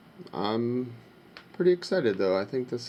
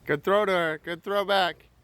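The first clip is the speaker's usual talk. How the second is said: high, raised voice across a ball field; calling out praise; 9 semitones higher, 3 dB louder than usual. Real, not acted. Parent praising a throw at ball practice, calling out. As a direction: call out encouragement to the players. Cheering players at a ball game.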